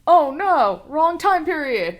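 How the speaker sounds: high, loud pretend dismay; playful exaggerated panic, drawn out at the end; mock-panicked time traveler. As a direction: cry out in hammed-up alarm.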